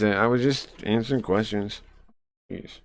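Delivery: ordinary talk, nothing marked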